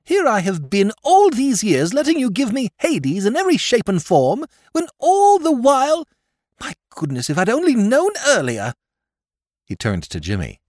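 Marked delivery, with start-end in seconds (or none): 0.0-8.7 put-on much higher, louder, aggrieved young lord; self-pitying lord. A narrator's reading. Lord Drever lamenting to Sir Thomas, self-pitying. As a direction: wail it in a fervour of self-pity. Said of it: "in a fervour of self-pity".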